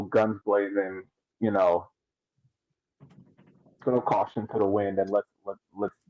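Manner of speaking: ordinary talk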